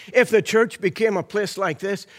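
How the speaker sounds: ordinary delivery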